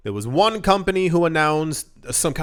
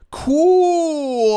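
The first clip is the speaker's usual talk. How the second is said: drawn-out excited cool; 11 semitones higher, 5 dB louder than usual. Real, not acted. Enthused reaction to news.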